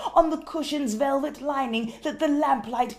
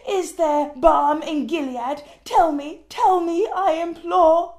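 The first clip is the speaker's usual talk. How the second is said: desperate imploring; 3 semitones higher, 8 dB louder than usual. Acted. Pleading with the prophet bird.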